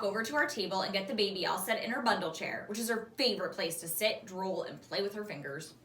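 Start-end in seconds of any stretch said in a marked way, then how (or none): none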